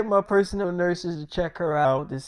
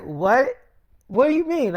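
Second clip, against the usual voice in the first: mildly startled, second question jumps higher; acted disbelief; 5 semitones higher than usual. Patient told she can't do the movie, mildly startled.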